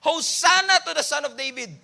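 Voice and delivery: preacher voicing the crowd's acclaim; crowd crying out. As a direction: call it out like the cheering crowd. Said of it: "lahat sila nagsisigawan".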